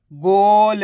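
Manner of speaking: गोल with a long held "o" and light final ल; half final letter. Shown as a demonstration. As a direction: draw out गोल for learners, halving the ल. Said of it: "last वाले अक्षर का उच्चारण आधा किया जाता है". (गोल को खींचकर, आखिरी ल आधा)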